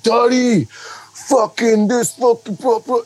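high, slurry drunk rambling, imitated; mock a fired-up drunk's cursing; drunk fan. Drunk guy cursing at a dad by his family, rowdy imitation. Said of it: "He's all drunk".

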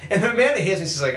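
usual speaking voice

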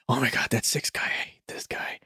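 very soft, low aside fading to a whisper; muttered listener complaint. Retold or imitated. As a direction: grumble it under your breath as an annoyed listener. Acts out a disgruntled listener.

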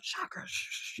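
hushed, secretive whisper with shushing. Retold or imitated. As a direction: whisper it and shush the other person. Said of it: "So we're, like, whispering".

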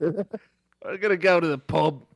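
slow, hammy British bloke voice; mock British accent. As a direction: lay on a silly pub-lad accent. Mock British pub talk.

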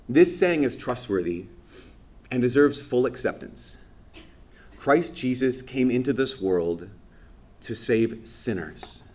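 slow, measured reading voice; scripture reading. Acted. Priest quoting Saint Paul to the congregation, slow and solemn. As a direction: read it out solemnly and slowly.

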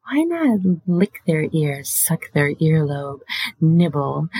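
ordinary delivery

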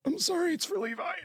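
high, soft whimpering mock voice; soft high mock apology; mock crying soldier. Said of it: "they're like".

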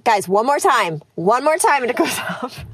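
angry mom voice. Shown as a demonstration. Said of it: "That's my, my angry mom voice".

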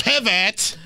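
high-pitched mock yell from Friends; Ross yelling. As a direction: yell it like Ross hauling a couch. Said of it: "with the couch yelling".

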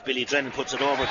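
ordinary talk

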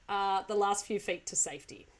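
ordinary talk

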